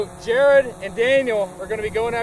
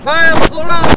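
yelling; 4 semitones higher, 8 dB louder than usual. Acted. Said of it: "I'm gonna be yelling words at you".

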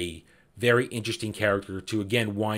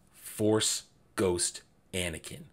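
slow dramatic reveal; 5 semitones lower, 8 dB quieter, slower than usual. Real, not acted. Dramatic reveal of book moment.

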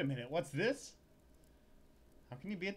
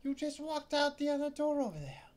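high, loud startled female clerk; a clerk jolted to see him back; shop clerk voice; 13 semitones higher, 6 dB louder than usual. Acted. Clerk snapping at Larry, high and loud.

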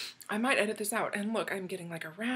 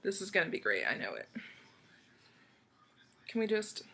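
normal talking voice

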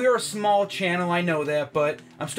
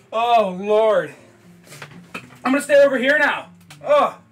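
higher, louder anguished cries; real pain outcry; 4 semitones higher, 6 dB louder than usual. Real, not acted. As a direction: cry out from the burn. Mouth burning, pacing away.

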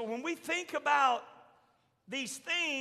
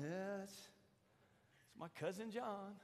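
soft, slow, much softer resigned murmur; a hint of fond exasperation; resigned sigh; 5 semitones lower, 17 dB quieter, slower than usual. Jesus watching his cousin John walk off, in a soft, slow, resigned voice. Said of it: "And Jesus is going".

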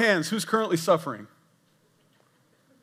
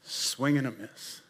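low dejected mutter; 4 semitones lower, 8 dB quieter, slower than usual. Pretend. Final call, low.

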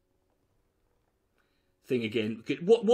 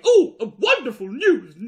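higher and louder, mock excited newsreader; 7 semitones higher, 10 dB louder than usual. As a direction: ham up a gushing news anchor. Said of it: "they weren't there going".

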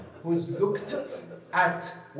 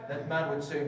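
usual speaking voice

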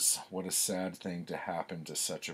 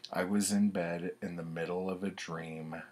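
usual speaking voice

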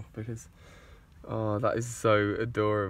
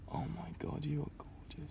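whispering; 9 dB quieter than usual. Real, not acted. Whispering to a tiny slow worm.

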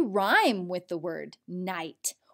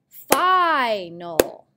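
"Final" with a loud, long "fi"; stretched first syllable; 5 dB louder than usual. Shown as a demonstration. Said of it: "The long I is at the end".

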